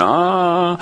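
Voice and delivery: high mimicked "Ah" of a girl who cannot speak; imitated wordless cry. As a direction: voice her bright wordless "Ah". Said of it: "She went".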